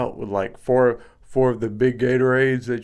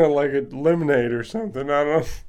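ordinary talk, nothing marked

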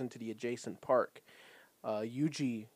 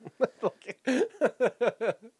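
giggle; 10 semitones higher, 4 dB louder than usual. Real, not acted. Laughing at own puppy voice.

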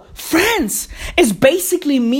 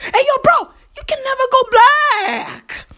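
much higher, mock-preachy repeat of the slogan; pitched-up slogan; 10 semitones higher than usual. Repeating his slogan to the crowd in a much higher voice. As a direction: fling the slogan up high like a hype man.

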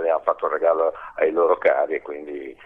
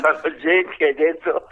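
high, giggly voice; holding back a laugh; stifled giggles; 9 semitones higher than usual. Real, not acted. Laughs at forgotten name.

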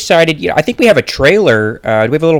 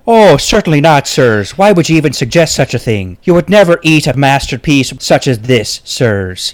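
affronted Irish brogue; Irish accent. Acted. Sculptor offended that they ask if it's edible, Irish accent. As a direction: protest as a proud, polite Irishman. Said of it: "It is an Irish accent".

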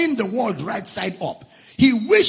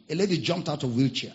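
usual speaking voice

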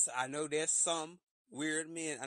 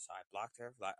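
ordinary delivery

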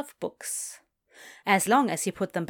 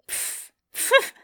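high puffs of held-back laughter; stifled laugh; 14 semitones higher than usual. Acted. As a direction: snort through pressed lips, trying not to laugh. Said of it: "to not burst out laughing".

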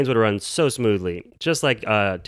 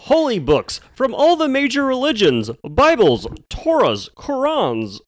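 high, loud game-show host voice; booming reality-show host pitch; Jonathan Karsh; 13 semitones higher, 5 dB louder than usual. Retold or imitated. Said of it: "in your Jonathan Karsh voice".

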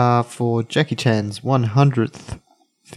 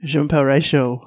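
mock French; 3 semitones higher than usual. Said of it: "isn't that French?".

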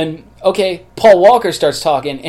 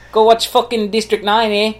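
put-on higher South African accent; 6 semitones higher than usual. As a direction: push the movie in South African. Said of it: "same accent continues".

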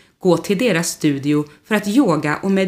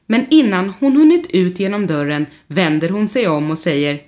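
ordinary delivery

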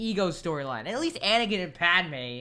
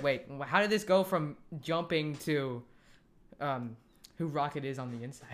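confused; 5 semitones lower, 6 dB quieter than usual. Retold or imitated. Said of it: "I was like".

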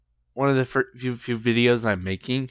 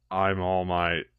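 low, quiet hero in everyday form; All Might, normal form; 5 semitones lower, 5 dB quieter, slower than usual. Acted. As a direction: say the hero's name small and low.

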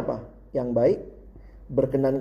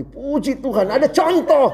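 retold inner rejoicing; 9 semitones higher than usual. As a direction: burst out in hammed-up thankful relief. Acts out his gleeful inner exclamation.

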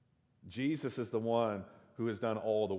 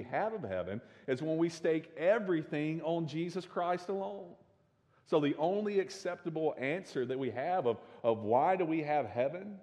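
raised, urgent pulpit voice building to a climax; full-force preaching; 5 semitones higher, 3 dB louder than usual. Acted. Pastor driving home the gospel to his congregation, impassioned preaching. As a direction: preach it out, louder and higher, then drop on the final words.

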